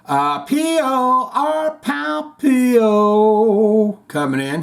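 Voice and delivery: drawn-out, high announcer call; slow and stretched, like a ring announcer calling a name. Acted. Announcing a viewer, far voice.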